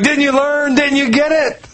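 lightly voiced scolding; exasperated scolding. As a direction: chide him like a let-down teacher. Jesus scolding Peter, retold.

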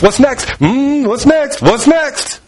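high, slow, longing; rising eager plea; eager asking. Retold or imitated. Believer asking Jesus eagerly, high and drawn out.

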